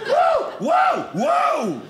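high startled shout; startled whoa. Retold or imitated. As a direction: jump at your own reflection. Scared by mirror reflection.

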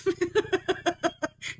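airy giggle; soft amused laugh; breathy laugh. Real, not acted. Laughing at bullhorn joke.

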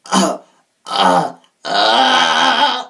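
loud coughed grunts building into a growl; throat-clearing growl. Shown as a demonstration. Coach showing how a growl works, throat-clearing grunts. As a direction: push out coughing growls, the last held. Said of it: "cough or clear your throat".

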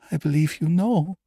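high-pitched, fearful woman; breathed confession. A narrator's reading. Frightened woman sensing the doctor knows, high and breathed. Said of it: "she breathed".

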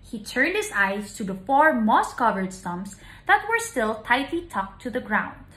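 usual speaking voice

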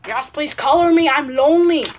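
whiny mock plea, punched up; Baldi. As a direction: beg loudly in a cartoon voice. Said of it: "Shut up, Baldi".

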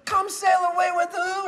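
high-pitched call-and-response prompt; hammed-up DJ holler; hype-man emcee. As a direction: goad the crowd into answering. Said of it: "He goes".

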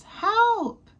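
high, far-off call for help from inside a log; high panicked little help cry. Acted. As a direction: squeal it out like a stuck bunny. Fluff stuck, calling for help.

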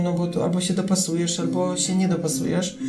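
ordinary delivery

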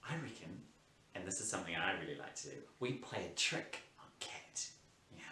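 hushed schoolboy scheming; conspiratorial hush. Acted. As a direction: let them in on a secret trick. Plotting trick, hushed.

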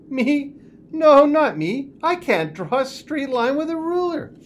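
put-on high, loud childlike protest; a small boy insisting he can't; protesting little boy.

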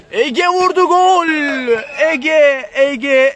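high, loud goal yell; shouted goal call. Real, not acted. Goal call with repeated name. (bağırarak gol anlatımı)

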